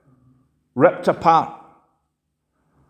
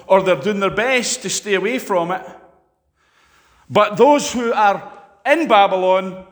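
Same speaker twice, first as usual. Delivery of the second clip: ordinary delivery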